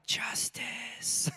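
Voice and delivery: soft satisfied whisper; hushed whisper of quiet triumph. Retold or imitated. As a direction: breathe it out under your breath. Inner reaction to kid falling.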